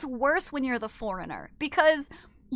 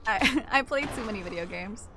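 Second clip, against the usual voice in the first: laughing; 3 semitones lower than usual. Real, not acted.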